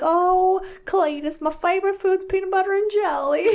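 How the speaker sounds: high, hammed-up hillbilly voice, teasing her son; mock hillbilly voice. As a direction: play a silly hillbilly to tease a kid.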